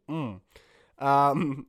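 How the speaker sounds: normal talking voice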